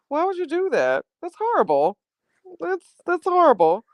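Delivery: very high, amused reproach; tickled scolding. Real, not acted. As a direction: scold them while tickled by it. Tickled, playfully scolding the prank.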